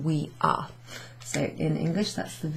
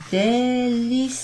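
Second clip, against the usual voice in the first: "délicieux" stretched syllable by syllable, higher; slow sounding-out; 6 semitones higher, 4 dB louder, slower than usual. Shown as a demonstration. Teacher spelling out the French for delicious, slowly stretched "délicieux". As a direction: draw out every syllable of "délicieux". Sounding out a word while writing.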